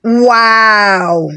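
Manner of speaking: put-on a thrilled child's cry of wow; delighted little boy's exclaim; excited wow. A narrator's reading. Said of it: "Ikem exclaimed".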